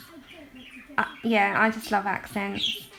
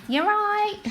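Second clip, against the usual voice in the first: higher-pitched Cockney catchphrase; Cockney accent; 10 semitones higher, 4 dB louder than usual. Shown as a demonstration. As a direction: say it in broad, raised-pitch Cockney. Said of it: "I used to be really Cockney".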